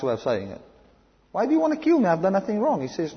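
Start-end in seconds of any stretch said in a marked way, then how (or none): 1.3-2.8 high pleading protest of an innocent king. Retold or imitated. The king protesting to God in a high plea. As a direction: cry out your innocence, voice lifted high.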